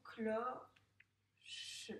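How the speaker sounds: "Cloche" in two beats, clo-che, final e sounded; split into syllables. Shown as a demonstration. Teacher tapping syllables for a pupil, "Cloche" split clo-che. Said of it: "tape-moi les syllabes du mot cloche".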